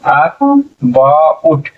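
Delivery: About very slowly, split as अ, बा, उट; syllable by syllable. Shown as a demonstration. Teacher answering how about reads, in slow syllables. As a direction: pause between each stretched part of about. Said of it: "क्या हो जाएगा".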